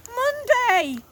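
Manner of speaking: very high, loud triumphant shout; boy shouting the answer; Jeremiah shouting. A narrator's reading. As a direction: blurt it out with glee. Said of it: "shouted Jeremiah".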